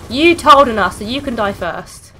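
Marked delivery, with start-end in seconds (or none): 0.1-1.0 loud taunting yell; shouting. Real, not acted. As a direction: yell it at the enemy. Yelling at enemy in game.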